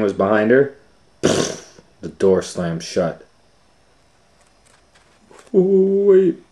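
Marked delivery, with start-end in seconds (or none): 5.5-6.4 high, loud sputter; sputtering Shaggy. Acted. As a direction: blurt it out in alarm.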